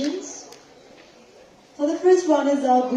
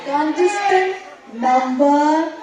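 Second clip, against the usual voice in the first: pageant announcer; 8 semitones higher, 7 dB louder than usual. Acted.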